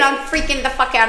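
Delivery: ordinary delivery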